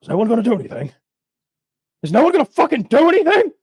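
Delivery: rising from a high plea to a shrieking yell; exasperated yell. Retold or imitated. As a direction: build from pleading to a frantic yell.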